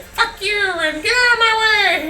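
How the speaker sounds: very high, squeaky meme voice; high-pitched mock New York rant; cartoon New Yorker Toad. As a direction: squeal it like an angry little Toad. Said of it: "some guy in the voice like".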